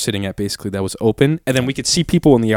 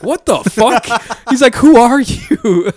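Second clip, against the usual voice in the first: mock baffled shout; 12 semitones higher, 6 dB louder, slower than usual. Tall friend baffled by insult.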